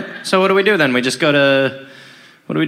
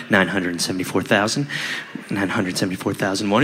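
low, quiet droning count; tired vote counter; 12 semitones lower, 8 dB quieter than usual. Retold or imitated. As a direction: drone the count wearily. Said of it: "they're like".

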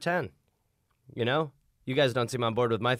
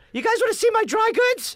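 shopkeeper voice; 4 dB louder than usual. Acted. Voicing a shopkeeper.

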